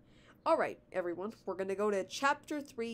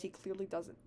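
usual speaking voice

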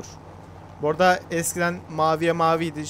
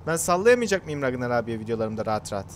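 ordinary talk

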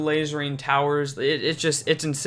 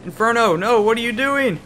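alarmed pleading; 4 semitones higher than usual. Real, not acted. Pleading with own card.

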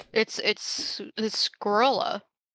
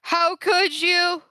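mock outrage; 7 semitones higher, slower than usual. Mock outrage at friend over squirrel.

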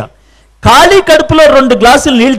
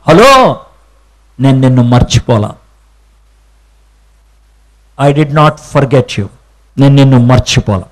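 tender divine reassurance; 11 semitones lower than usual. Acted. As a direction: speak it gently as God comforting. God's tender reply. (మృదువైన, నెమ్మదైన దేవుని స్వరం)

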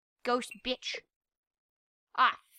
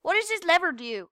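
ordinary talk, nothing marked

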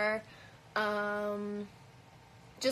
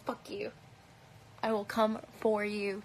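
normal talking voice

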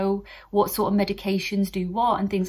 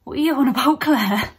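louder, higher baffled colleague imitation; mock bafflement; 4 semitones higher, 6 dB louder than usual. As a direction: act out a baffled doctor calling her out. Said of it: "they're just like".

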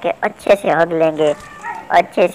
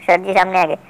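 ordinary talk

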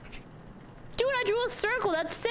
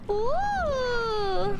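excited whoop; 9 semitones higher than usual. Real, not acted. Cheering in game.

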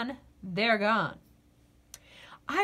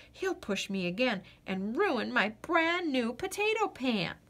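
plain reading voice

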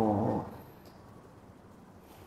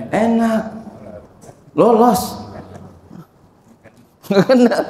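gembira; 8 semitones higher than usual. Acted. Senang lolos dari razia polisi.

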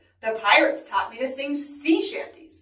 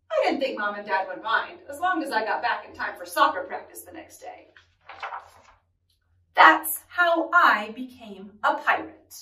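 usual speaking voice

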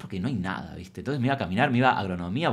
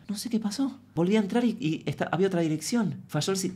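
lightly raised, faux-innocent excuse voice; 7 semitones higher than usual. Comedian mimicking her own fake-innocent excuse to the boss. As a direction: play dumb, a touch higher. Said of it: "pero decir".

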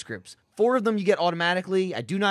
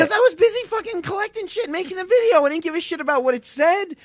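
high, loud indignant outburst; exasperated rant; 11 semitones higher, 5 dB louder than usual. Real, not acted. Man defending himself for not reading it, exasperated rant. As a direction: rant back at a much higher pitch.

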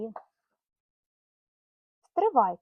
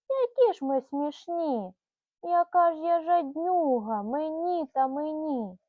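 higher, louder cry of a laughing squirrel; mock exclamation; 4 semitones higher, 7 dB louder than usual. Mother voicing Mary the squirrel, excited higher, louder cry. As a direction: exclaim it like Mary caught in her own greed. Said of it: "І скрикнула Мері".

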